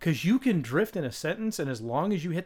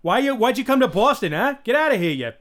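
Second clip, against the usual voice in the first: loud, high, hammed-up Boston accent; 6 semitones higher, 9 dB louder than usual. Shown as a demonstration. Showing a dialect slipping into Boston, brash Boston accent. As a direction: bellow it like a pushy Bostonian. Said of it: "you're in Boston all of a sudden".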